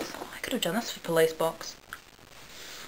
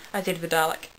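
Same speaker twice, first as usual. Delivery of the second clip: usual speaking voice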